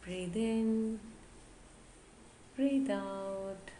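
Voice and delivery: slow, measured yoga nidra guidance; guided meditation. Acted. Yoga teacher guiding listeners to relax the whole body, guided meditation. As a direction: lead them gently through the body, phrase by phrase with long pauses.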